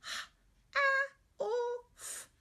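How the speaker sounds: put-on high, childlike letter-by-letter; teacher playing the student who sounds out each letter; child spelling aloud. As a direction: spell it like a young pupil. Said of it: "Sound it out".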